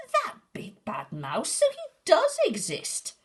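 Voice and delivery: very high, loud gasp of surprise; shocked child. Acted. Gruffalo's child realises the mouse is real, shrieking. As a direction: shriek in disbelief.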